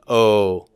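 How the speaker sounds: "O" as a long round oh; omega O sound. Shown as a demonstration. Teacher leading class in repeating the O. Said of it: "pronounce that as an O sound, everybody".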